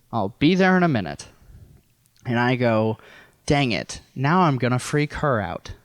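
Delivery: ordinary talk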